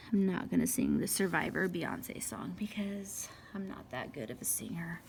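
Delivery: soft confiding whisper; murmured confession; whispered aside. Real, not acted. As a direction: let the audience in on a secret.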